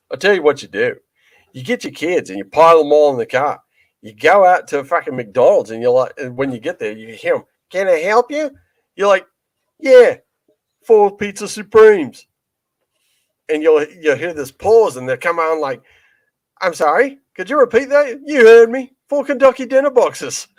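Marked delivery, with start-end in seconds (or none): 0.1-12.3 broad Aussie comic rant; Australian accent. Retold or imitated. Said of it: "I'm gonna try to imitate his accent". 16.6-20.5 high Aussie drive-through banter; Aussie comic bit, pitched up; Australian accent. Retold or imitated. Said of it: "imitate his accent".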